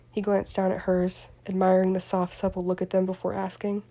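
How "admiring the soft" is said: plain reading voice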